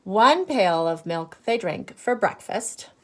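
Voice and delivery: normal talking voice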